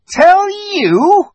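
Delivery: very high, loud indignant question; shrill witch. A narrator's reading. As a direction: screech it as an outraged witch. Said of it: "growing suddenly shriller".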